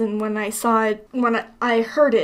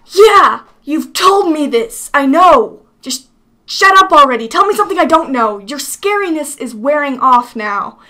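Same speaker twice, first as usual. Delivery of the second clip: exasperated, loud, raised-pitch retort; Link; 7 dB louder than usual. Acted. Hero fed up with the villain's threats, exasperated. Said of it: "Link's like".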